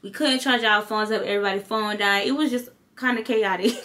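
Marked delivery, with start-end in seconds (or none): none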